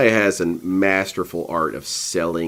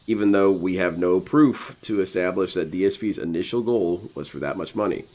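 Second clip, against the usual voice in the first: ordinary delivery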